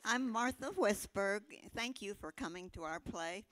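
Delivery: ordinary talk, nothing marked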